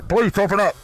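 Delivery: high, hammy cop at the door. Pretend. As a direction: knock and demand entry. Playing cops at the door.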